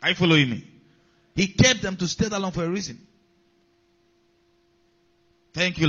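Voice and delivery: ordinary delivery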